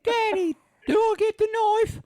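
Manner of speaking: put-on high, fast little-kid voice; little son asking his dad for the knife, high and fast; child voice. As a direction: squeak it out like an eager small boy. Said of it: "voicing the little son".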